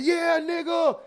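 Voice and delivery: father cheering his son's dunk, high shout. Retold or imitated. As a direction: cheer like your kid just dunked. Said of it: "I be like".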